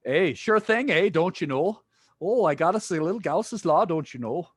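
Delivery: high, hammed-up Canadian accent; bad Canadian accent. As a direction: play a cartoon Canadian, "don't you know". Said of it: "My Canadian accent's pretty bad".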